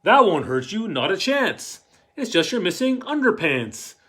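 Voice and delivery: put-on loud, lively read-aloud to kids; a parent tucking a child in, read out loud and animated; storybook reading. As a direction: read it big for the children in the back row. Parent role in the picture book.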